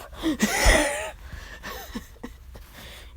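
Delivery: wheezy high laugh. Real, not acted. Storyteller cracking up at his own joke, wheezy laugh.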